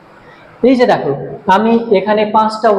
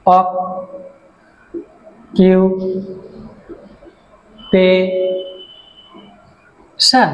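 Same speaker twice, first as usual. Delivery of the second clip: Occupation broken into four syllables with long pauses; much slower than usual. Shown as a demonstration. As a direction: say occupation very slowly, one separate syllable at a time. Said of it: "চারটা syllabus আছে".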